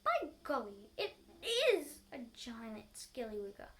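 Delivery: loud, slow dramatic warning; hammed-up alarm. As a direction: spell it out loud to scare her. Husband frightening wife.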